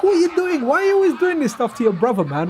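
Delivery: high, loud mock telling-off; exasperated dad. Dad scolding son who trolls his brother, high and loud. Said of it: "And I'm like".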